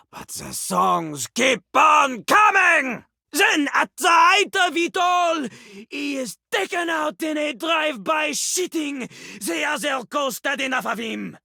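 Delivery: high, emphatic storytelling; hammed-up dramatic narration; dramatic build. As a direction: swell into the climax.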